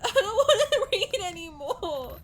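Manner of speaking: loud tearful laughing whine; laugh-cry the complaint; real teary laughing protest. Real, not acted. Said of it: "laughs shout".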